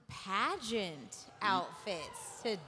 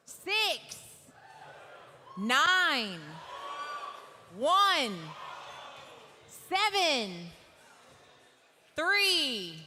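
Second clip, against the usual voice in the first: higher, louder, announcing each number; calling out numbers; 5 semitones higher, 4 dB louder, much slower than usual. Real, not acted. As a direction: call the winner out to the room.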